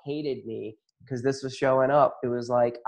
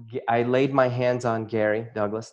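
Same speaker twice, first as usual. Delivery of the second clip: normal talking voice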